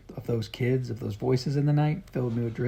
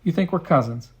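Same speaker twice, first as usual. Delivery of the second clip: higher and louder in disbelief; astonished; 5 semitones higher, 6 dB louder than usual. A narrator's reading. Boy hears they are cousins, astonished. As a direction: caught off guard by the news. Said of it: "Daniel looked absolutely astonished".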